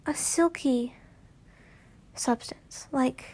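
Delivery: breathy hushed reading; whispered narration. A narrator's reading. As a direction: drop to a whisper.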